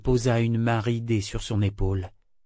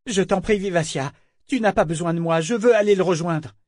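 high, raised-pitch entreaty; urgent pleading; 7 semitones higher than usual. A narrator's reading. Althea to her ship, wanting to join Brachen, pleading. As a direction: plead with them to let you go.

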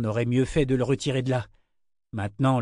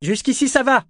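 high, raised voice calling out; loud call; 11 semitones higher, 5 dB louder than usual. A narrator's reading. Althea reporting from inside the ship, loud call. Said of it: "Althea lança d'une voix forte".

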